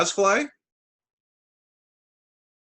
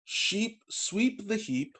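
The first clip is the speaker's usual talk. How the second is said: slow and soft; hushed storybook line; 5 semitones higher, 6 dB quieter, much slower than usual. Pretend. Reading to a child, slow hushed delivery. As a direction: read it slowly under the breath.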